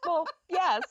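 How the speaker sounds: quoting the movie line through laughter; laughing quote. Retold or imitated. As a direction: retell it while giggling. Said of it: "It's like".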